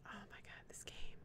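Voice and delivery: breathy exasperated whisper; real defeated whisper. Real, not acted. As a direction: whisper it under your breath in disbelief. Frustrated aside while playing.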